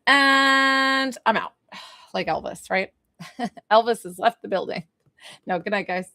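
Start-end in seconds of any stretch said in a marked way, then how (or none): 0.1-1.5 very slow stretched "I'm out"; drawn-out sign-off. Real, not acted. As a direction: let it trail off theatrically. Signing off the live.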